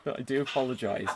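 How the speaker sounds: ordinary talk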